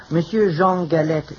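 put-on French accent, French-style Jean; phony French accent. Hairdresser giving his salon name, phony French accent. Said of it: "without the phony French accent".

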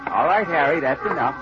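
ordinary delivery

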